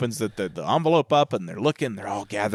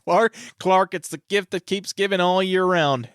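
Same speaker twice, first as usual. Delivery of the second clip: higher, put-on voice of the cousin; quoted movie line; 8 semitones higher than usual. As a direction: play the clueless cousin, chipper. Quoting cousin's jelly club line.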